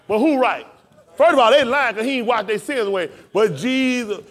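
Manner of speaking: full-force preaching, high and loud. Real, not acted. Preacher telling the congregation he will walk among them, preaching. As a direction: preach it with driving intensity.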